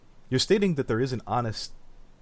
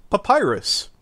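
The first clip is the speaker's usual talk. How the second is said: excited anime fan; 10 semitones higher, 7 dB louder than usual. Acted. Gushing over a fan name.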